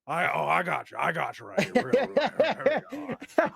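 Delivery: loud, high, building Pacino voice; hammy Pacino revving up; Al Pacino impression. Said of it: "And Al goes".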